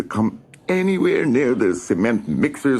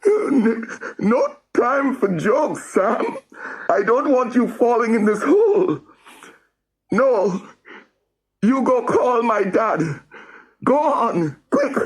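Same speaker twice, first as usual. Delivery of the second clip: tearful urging. Acted. Rory crying at Sam to go fetch his dad. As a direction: urge him on through tears.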